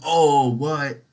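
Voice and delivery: big drawn-out loud "oh"; mock astonishment. American reacting on hearing Amsterdam, mock astonished. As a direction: play up the amazed reaction.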